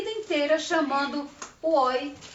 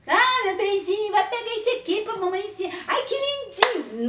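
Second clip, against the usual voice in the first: high, gushing mock baby talk; doting baby talk; 8 semitones higher than usual. Shown as a demonstration. Teacher mocking a fawning mother's baby talk. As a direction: coo over the child like a fawning mother. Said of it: "Não babado em cima".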